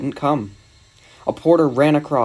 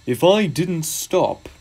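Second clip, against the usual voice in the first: slow, higher-pitched pondering; engine musing; 5 semitones higher, much slower than usual. Acted. Gordon scheming about reaching London, musing.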